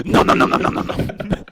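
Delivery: voiceless nom-nom gobbling; mimicked greedy munching. Mocking her diving back into the chicken after complaining, greedy munching.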